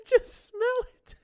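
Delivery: high, slow, laughing plea; squeaky drawn-out mock plea. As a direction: hold it out and beg while giggling. Voicing the woman holding out cilantro.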